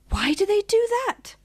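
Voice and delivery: high little questioning voice; high-pitched innocent wonder; puzzled small animal. A narrator's reading. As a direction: ask like a bewildered young creature.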